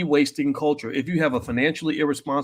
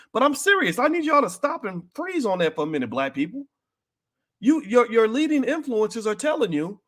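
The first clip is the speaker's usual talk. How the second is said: fiery preaching; 9 semitones higher than usual. Real, not acted. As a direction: preach it at full force to the congregation.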